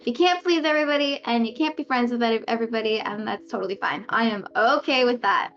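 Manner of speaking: normal talking voice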